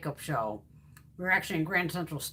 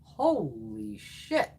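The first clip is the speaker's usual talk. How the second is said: slow, drawn-out awed gasp of words; amazed; 5 semitones higher, much slower than usual. Real, not acted. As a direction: stretch it out in disbelief. Amazed at highlighter swatch.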